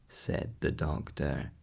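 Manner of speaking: plain reading voice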